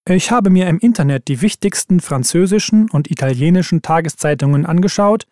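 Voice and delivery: ordinary talk, nothing marked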